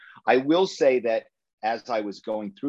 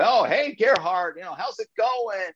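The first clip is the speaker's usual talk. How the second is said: imitating a chummy executive, much higher; a friendly exec happily greeting Gerhard, much higher; eager executive greeting; 12 semitones higher than usual. Executive greets fake German caller.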